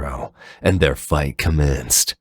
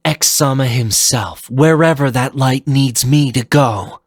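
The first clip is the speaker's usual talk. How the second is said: high, louder firm declaration; resolute shadow; 9 semitones higher, 4 dB louder than usual. Acted. Phantom answers Zero, resolute and firm. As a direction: steel yourself and declare duty.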